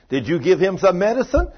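can't believe the change; amazed mother. Retold or imitated.

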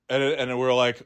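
usual speaking voice

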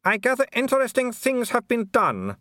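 high, polite baroness voice with a thin smile; a smiling aristocrat who means none of it; cool hostess smile. A narrator's reading. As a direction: welcome him coolly through a fixed smile.

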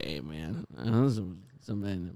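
low, quiet, creaky vocal fry; Robert's vocal fry. Retold or imitated. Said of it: "that weird vocal fry where he's like".

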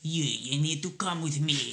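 thick fake Russian accent, hammed up; cod Russian accent. Shown as a demonstration. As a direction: do a cheesy movie Russian. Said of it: "demonstrate a cod Russian accent".

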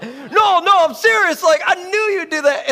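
won't take no for an answer, shouting; insistent hype. Retold or imitated. Tall teammate insisting to Neil he meant it, loud and high.

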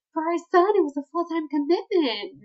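high, loud parental put-down; mock snooty parents. Voices snooty parents quizzing Macy.

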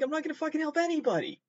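high, fast, fed-up mock rant; mock frustrated outburst. To herself, giving up on learning, in a high fast huff. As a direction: blurt it out like a sulky quitter. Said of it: "and I'm like".

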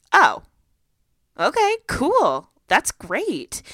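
high, loud mom reaction, drawn out; retold upbeat surprise; surprised acceptance. Mom reacting to daughter coming out.

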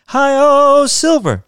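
loud, high Lone Ranger cry; play-acting kid's rallying yell; ringing cowboy call. A narrator's reading. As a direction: holler it out with a long drawn "oh". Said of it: "Muffin's voice rang out again".

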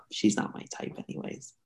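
hushed unvoiced aside; whispered aside. Real, not acted. Whispering an aside to the listener about her friend.

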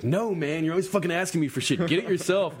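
mild mock snap. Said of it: "Finally, Fonz just snaps".

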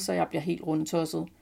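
ordinary delivery, nothing marked

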